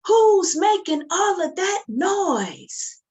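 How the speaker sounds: high, loud and slow demand; booming parent call. Shown as a demonstration. Storyteller leading kids in chorus, loud. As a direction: call it out big for the kids to copy. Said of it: "Let's try it together".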